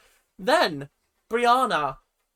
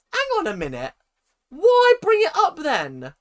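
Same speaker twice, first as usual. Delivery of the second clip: incredulous; 11 semitones higher, 7 dB louder than usual. Retold or imitated. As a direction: stretch out the disbelief as you question it. Own baffled reaction to Brianna.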